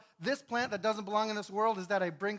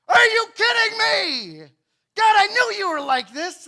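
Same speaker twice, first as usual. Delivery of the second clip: much higher, much louder, outraged; furious outburst; 13 semitones higher, 16 dB louder than usual. Acted. Jonah shouting at God over the eaten plant, furious. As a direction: yell at God in disbelief.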